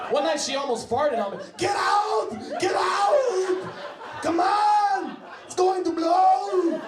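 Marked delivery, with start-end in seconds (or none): 1.6-6.8 high, loud frantic orders; hammed-up panicked evacuation shout; mock action-movie yell. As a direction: girlfriend about to fart, shouting like an action hero. Girlfriend yelling when she almost farts.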